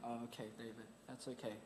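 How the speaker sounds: put-on soft, reassuring hush to a flustered old man; calm him down softly; quiet soothing words to an upset elderly delegate; gentle reassurance.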